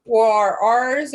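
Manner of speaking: ordinary talk, nothing marked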